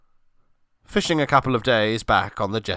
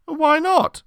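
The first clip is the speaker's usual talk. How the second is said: much higher, carefree innocent voice; innocent friend voice; 4 dB louder than usual. Acted. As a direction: sound pleased and clueless. Chris voiced innocently in story.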